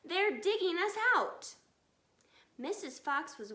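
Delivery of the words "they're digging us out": high, loud panicked shout; alarmed shout. Acted. Fox father warning family the farmers are digging, shouting. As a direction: raise the alarm at full voice. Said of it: "he shouted".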